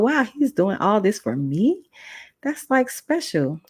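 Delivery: slow, high, awed; amazed. Retold or imitated. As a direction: let the wonder stretch out slowly. Touched by teacher's gesture.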